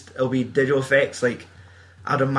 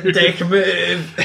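mock aggrieved guard; 5 semitones higher than usual. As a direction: play the duped guy, hammed up. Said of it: "And the guy's like".

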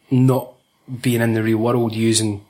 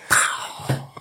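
whispered pow; 5 dB quieter than usual. Retold or imitated. Sound effect after name drop.

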